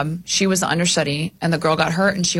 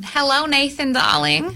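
put-on old-time New York twang, lifted high; New York accent; 6 semitones higher, slower than usual. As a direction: play a 1950s New York dame. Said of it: "give us a little of that".

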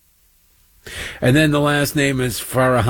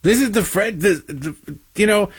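stammering, higher, baffled disbelief; incredulous stammer; 7 semitones higher than usual. Retold or imitated. Host mocking the tame French scandal, stammering in disbelief. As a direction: sputter like you can't believe it. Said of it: "And you go".

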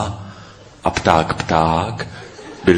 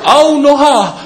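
high, loud cry of pain; roar of pain; 6 dB louder than usual. A narrator's reading. Bird Noach falling on his friend, in a loud pained yell. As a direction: howl out in comic pain. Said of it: "zařval bolestí pták Noach".

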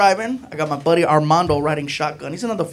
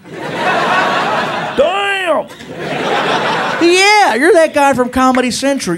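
high, loud burst of recognition; mock starstruck officer; 9 semitones higher, 3 dB louder, much slower than usual. Officer recognizing TV comedian, mock excited outburst. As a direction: imitate a thrilled fan in uniform. Cop delighted to recognize comic.

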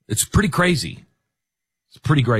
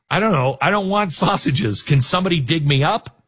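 higher-pitched, pretend buried man; hammed-up reluctant whine from underground; mock complaining plea; 7 semitones higher than usual. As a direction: plead comically to be let out. Voicing the buried doctor's plea.

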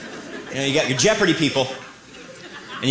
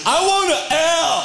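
dim Wheel of Fortune contestant; 12 semitones higher, slower than usual. Retold or imitated. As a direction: call out the letter like a clueless player. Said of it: "Turn to Wheel of Fortune".

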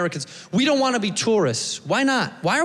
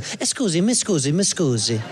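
put-on Italian accent; 3 semitones lower than usual. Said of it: "cute little Italian couple that's like".

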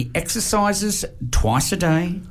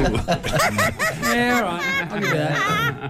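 laughing; much slower than usual. Real, not acted. Conceding while laughing.

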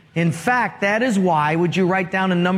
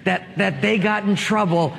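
usual speaking voice

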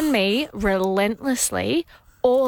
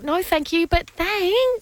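higher, sing-song polite refusal; sweet brush-off; 5 semitones higher, slower than usual. Pretend. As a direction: turn him down sweetly.

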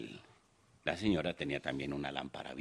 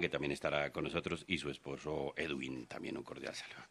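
ordinary talk

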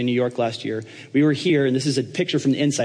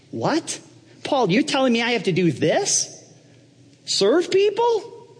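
high-pitched disbelief, climbing higher; incredulous; 13 semitones higher than usual. Acted. Trump protesting to Paul about serving people, high and incredulous. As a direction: balk in mock outrage, voice shooting up.

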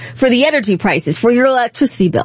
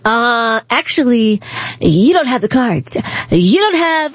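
lower-pitched mock presidential rebuke; Trump impression; 4 semitones lower than usual. Host mimics president rebuking Ukraine's leader, low put-on voice. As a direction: imitate a president putting a visitor in his place. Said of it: "then President Trump says".

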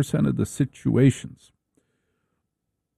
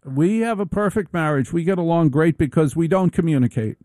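higher voice quoting a female comic; quoted comic; 5 semitones higher than usual. Retold or imitated. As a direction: lift the voice into her deadpan. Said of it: "she says".

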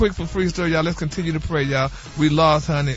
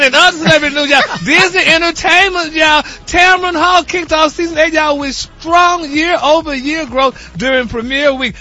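over-the-top gossip anchor, y'all; gossip news read; 7 semitones higher, 5 dB louder than usual. Acted. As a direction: sell the headline like hot tea.

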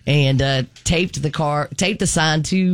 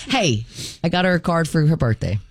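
usual speaking voice

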